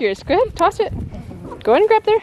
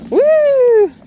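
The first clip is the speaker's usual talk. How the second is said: high, loud whoop; cheer; 13 semitones higher, 8 dB louder than usual. Real, not acted. Mom cheers her son's toss with a high, loud whoop.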